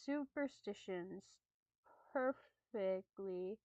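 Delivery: measured, slightly hushed storytelling; story narration. Acted. Streamer reads creepy camping story to viewers, storytelling voice. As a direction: read it like a campfire tale.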